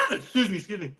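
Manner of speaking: ordinary delivery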